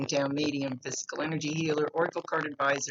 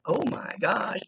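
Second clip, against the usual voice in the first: put-on light valley girl gush, higher; 7 semitones higher, 4 dB louder, slower than usual. Medium imitating a spirit as a valley girl. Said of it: "wants to talk like a valley girl".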